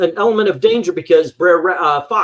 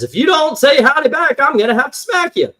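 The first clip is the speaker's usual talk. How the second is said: mock angry Brer Rabbit; 10 semitones higher than usual. Brer Rabbit threatening the silent Tar Baby, mock angry. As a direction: huff it out like an offended rabbit. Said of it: "gets mad and he says".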